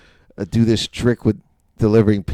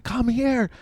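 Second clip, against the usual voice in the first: high, softer mock woman's voice; imitating an amazed lady; excited woman customer; 13 semitones higher, 6 dB quieter than usual. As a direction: beckon in a high, hushed voice. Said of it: "She's like".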